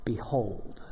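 behold said low and soft, drawn out; solemn behold. Shown as a demonstration. Pastor lowers voice to introduce behold. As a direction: let behold sink in quietly. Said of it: "And that word is this".

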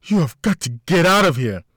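loud, high pleading yell; urgent shout. A narrator's reading. Woman keeps pleading under fire.

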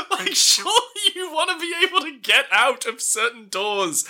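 ordinary talk, nothing marked

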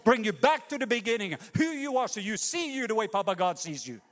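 high and loud, building to a peak; full-force preaching. Acted. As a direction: preach it like a rising call.

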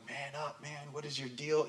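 soft, low-volume mock scolding of a baby; mock chiding. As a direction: chide a newborn under your voice, jokingly. Jokingly chides his crying newborn.

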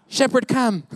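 a hint of a wife calling out, rising high; wife calling for shepherd. Pastor imitating his wife calling out for the shepherd. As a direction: call for the missing shepherd, half-laughing. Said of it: "she's like".

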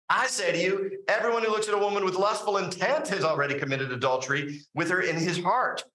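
voicing Jesus emphatically. Jesus quoted, higher and louder.

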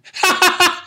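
real giggle, high and loud; high squeaky laugh. Real, not acted. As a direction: laugh at your own pun. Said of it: "We call it a headphone".

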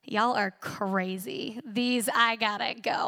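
a hint of a leery outsider backing away; put-off skeptic. Young self among hand-raising worshippers, lightly voiced wary dismissal. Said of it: "I was like".

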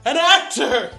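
topping the last take with grander theatrics; hammed-up thespian. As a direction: go full stagey luvvie. Mock-grand claim to be actor.

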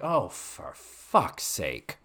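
slow, drawn-out exasperation; exasperated. Retold or imitated. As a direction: let it out slowly, completely fed up. Teacher fed up, paraphrased.

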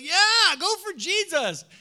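rally them with a big yes; enthusiastic cheer. Acted. Other church leaders cheering the church plant, excited.

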